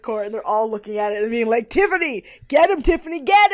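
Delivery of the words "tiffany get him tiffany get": high excited egging-on; root for her like a crowd; mock cheer, get him.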